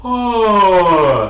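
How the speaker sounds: yawn-lifted "Oh"; start of a yawn. Shown as a demonstration. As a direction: let a yawn lift the voice into "Oh". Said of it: "think about going".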